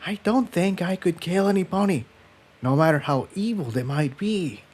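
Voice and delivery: voice dropped. Acted. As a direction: say it as a near-whisper confession. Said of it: "her voice dropped in volume".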